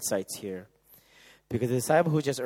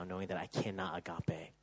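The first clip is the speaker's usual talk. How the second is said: hushed whispered realization; 5 semitones lower, 8 dB quieter than usual. Acted. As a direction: breathe the realization.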